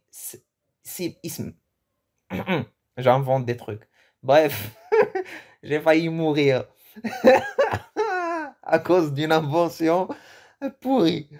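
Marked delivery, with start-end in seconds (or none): 4.9-5.4 laugh. Real, not acted. Laughing at own invention. 5.6-11.3 rire en parlant, voix haute; éclats de rire entre les mots; laughing. Real, not acted. Laughing while telling.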